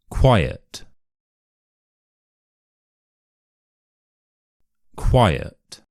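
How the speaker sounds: single words read out for repetition, falling tone; British pronunciation model. Shown as a demonstration. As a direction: say each word carefully in a British accent, pausing after it. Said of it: "I'm going to read out ten easy words".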